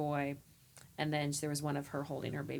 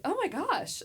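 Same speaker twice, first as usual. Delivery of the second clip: squealed much higher and louder; squealing oh my gosh; overwhelmed gasp; 14 semitones higher, 6 dB louder than usual. Retold or imitated. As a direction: gush like you're floored. Overwhelmed by fan's praise.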